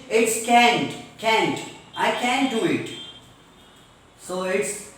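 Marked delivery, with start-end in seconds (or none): 0.5-1.6 CAN'T with a front "a" as in cat; flat-vowel American "kant", CAN'T rhyming with pant. Shown as a demonstration. Teacher modelling American English for class, CAN'T with cat vowel. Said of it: "And in American English". 2.0-2.9 CAN'T with the front "a" of cat; American can't. Shown as a demonstration. Teacher giving an American example, CAN'T with cat vowel. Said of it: "And in American English".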